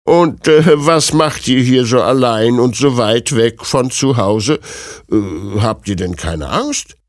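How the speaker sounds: put-on old granny voice, questioning; concerned old woman. Old woman asks the lone princess about fear, elderly voice. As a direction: ask as a wondering old woman, hesitating.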